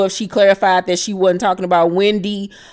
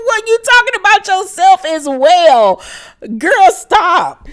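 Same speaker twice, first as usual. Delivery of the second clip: incredulous laugh; 11 semitones higher, 3 dB louder than usual. Real, not acted. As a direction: laugh it off in disbelief, then call her out. Reacting to "bitches ain't loyal.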